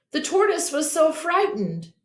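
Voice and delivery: usual speaking voice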